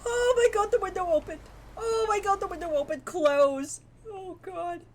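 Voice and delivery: high, loud panicked cries; terrified shriek. Real, not acted. Player scared by window flying open, frightened shrieks. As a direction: scream and babble in real fright.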